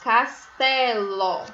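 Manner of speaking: castelo very slowly as cas-té-ló; teacher recapping castelo in drawn-out syllables. Shown as a demonstration. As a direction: separate CAS, TÉ, LÓ evenly. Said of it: "a terceira sílaba é o ló".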